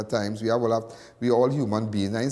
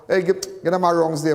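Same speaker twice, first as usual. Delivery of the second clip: higher, bright-eyed hello to strangers; cheery greeting; 7 semitones higher, 3 dB louder than usual. Retold or imitated. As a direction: greet everyone like an old friend. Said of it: "I could go up to people just so".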